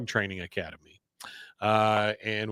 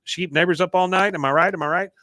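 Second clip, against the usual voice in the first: jokey trainer; 5 semitones higher, 5 dB louder, faster than usual. Retold or imitated. Voicing jokey dog trainer.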